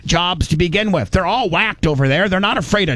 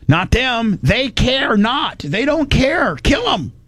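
higher, rising heated rant; 7 semitones higher, slower than usual. Real, not acted. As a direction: get worked up. Ranting about enemies.

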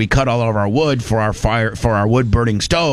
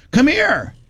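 calling over; 12 semitones higher than usual. Retold or imitated. Mom calling Doug over.

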